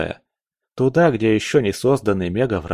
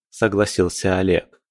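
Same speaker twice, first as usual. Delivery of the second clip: ordinary delivery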